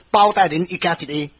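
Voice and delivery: plain reading voice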